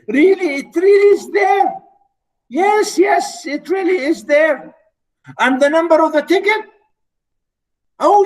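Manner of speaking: a husband sure the ticket has won; high excited babbling; excited insisting. Acted.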